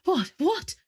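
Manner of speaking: groggy startled, pitch jumping up; startled what. Acted. Woken daughter startled by the news, sharp rising question. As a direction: jolt awake and ask twice.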